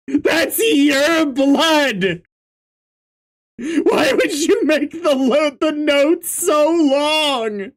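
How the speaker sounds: high, drawn-out shout; incredulous. Real, not acted. Reader berating character over blood note, high incredulous shouting.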